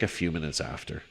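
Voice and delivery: ordinary delivery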